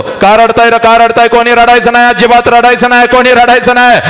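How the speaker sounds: a father's firm shout at the wedding hall. Retold or imitated. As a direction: order everyone to stop crying. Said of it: "सगळ्यांना ओरडतो".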